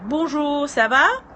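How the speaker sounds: high, bright French hello; French greeting. Acted. Greeting French viewers joining the stream, high cheerful hello. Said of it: "I always do that when, um, I have French, uh, people joining".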